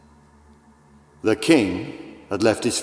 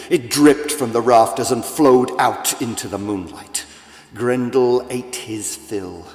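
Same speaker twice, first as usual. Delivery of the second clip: plain reading voice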